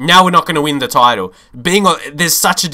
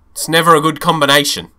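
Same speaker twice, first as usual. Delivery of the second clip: ordinary delivery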